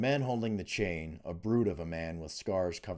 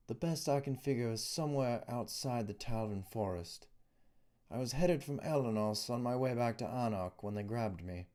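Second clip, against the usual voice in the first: lightly voiced young captive, a little higher; a nervous young man in a cell, somewhat higher; young prisoner Adrian, uneasy; 6 semitones higher than usual. A narrator's reading. Adrian explaining to Dylas through the wall, uneasy and higher.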